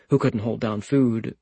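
usual speaking voice

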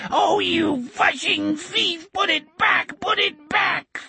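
high, slow, droning bug voice; whining insect voice. A narrator's reading. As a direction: whine it like a giant spider. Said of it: "in its whining, droning insect's voice".

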